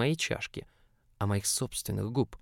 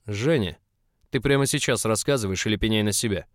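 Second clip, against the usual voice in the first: louder, menacing growl; 6 dB louder than usual. A narrator's reading. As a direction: growl the threat. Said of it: "прорычал Доля".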